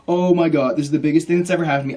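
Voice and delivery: loud, fast panicky outburst; acted loud freak-out. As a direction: rush it out in a mock panic. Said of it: "I am freaking out".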